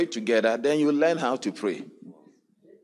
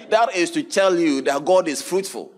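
loud, raised preacher's voice; preaching at full force; 7 semitones higher, 4 dB louder than usual. Acted. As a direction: drive the verse home to the crowd. Preaching the verse again.

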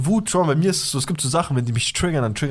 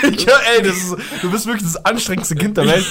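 talking through real laughter; words breaking with laughter; laughing; 7 semitones higher, 4 dB louder than usual. Real, not acted. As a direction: laugh it out, high and loud. Laughing at friend's story.

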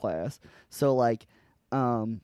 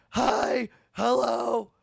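very high, mock tonsil-trouble voice; high, whiny caricature ordering at the drive-through; loudmouth twin; 10 semitones higher, 3 dB louder, slower than usual. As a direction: imitate her prank order, drawing words out. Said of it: "they're all loudmouth".

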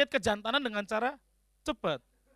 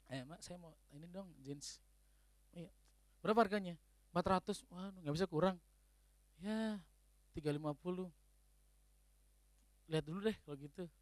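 much softer retold bargaining; quiet haggling; 5 semitones lower, 13 dB quieter than usual. Man haggling with a shopkeeper over jeans, softly. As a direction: murmur the haggle like an aside.

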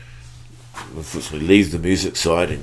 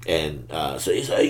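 normal talking voice

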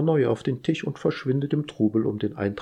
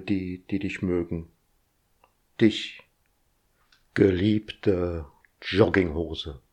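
Gedichtvortrag. Acted. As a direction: recite it like a solemn ode. Reads his own ode. (feierlich vorgetragene Ode, leise)

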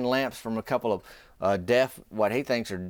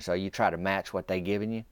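ordinary delivery

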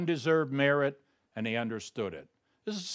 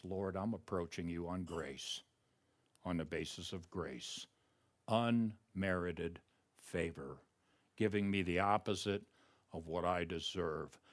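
barely above a breath, softer, unhurried; hushed prayer; 8 semitones lower, 10 dB quieter than usual. Shown as a demonstration. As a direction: lay the words down gently before God. Preacher models prayer on grace.

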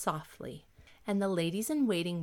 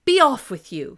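furious, much louder shout; angry emperor; 4 semitones higher, 13 dB louder than usual. A narrator's reading. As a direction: banish them with a roar. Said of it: "for he was very angry".

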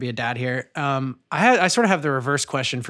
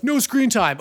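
higher-pitched mock strictness; mock parental rule; 8 semitones higher than usual. Parent to kids about screens, mock strict. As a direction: imitate a parent laying down the law.

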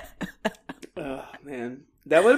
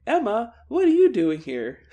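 raised, louder voice of surprise; mock startled question; 8 semitones higher, 5 dB louder than usual. Snake and Joey finding Emma outside, mock startled question. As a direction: call it out, higher, in mock bafflement.

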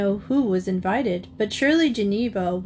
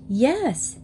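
put-on high, thrilled girl's yes; 4 semitones higher than usual. A narrator's reading. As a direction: burst with delight. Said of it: "Anna sounded thrilled".

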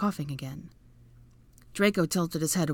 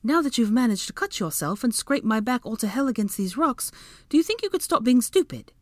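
high voice climbing higher and louder; scold, rising to a high pitch; exasperated scolding; 7 semitones higher, 4 dB louder than usual. A narrator's reading. Woman scraped on rocks scolding her companion, exasperated. Hermione scolds Draco after injury.

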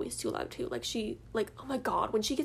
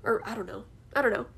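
ordinary delivery

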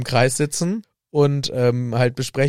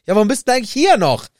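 fast, much higher, louder angry challenge; angry Harry; 9 semitones higher, 5 dB louder, much faster than usual. Acted. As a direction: snap it at Ron as Harry. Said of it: "Harry sagt dann auch".

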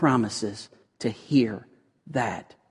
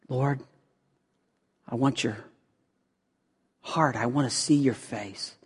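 intimate prayer. Acted. Voices an intimate prayer to God.

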